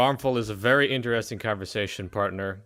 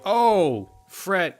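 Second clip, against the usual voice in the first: loud, higher-pitched acted groan; exasperated Grumble; 7 semitones higher, 6 dB louder than usual. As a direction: groan the name in mock exasperation. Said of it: "He's like".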